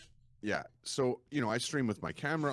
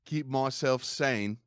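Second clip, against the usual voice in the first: emphatic, hammed-up British accent; awful British accent; 5 dB louder than usual. Shown as a demonstration. Streamer in character pleading with chat, bad British accent. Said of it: "in character with an awful accent".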